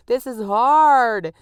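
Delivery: loud, drawn-out comic wail of exhaustion; hammed-up worn-out whine at full volume; exasperated venting. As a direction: belt out the complaint, high and stretched. Acting out exhausted venting, loudly.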